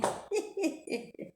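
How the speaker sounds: risadinha aguda e sem voz; risada soprada. Real, not acted. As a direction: ri baixinho, soprado. Laughs after her remark. (risada aguda e soprada)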